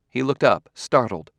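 plain reading voice

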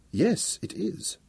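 slow, soft, awed; drawn out in quiet wonder; girl staring at the glowing equations, slowly. Acted. Wanda's slow reply.

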